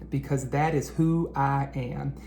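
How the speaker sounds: ordinary delivery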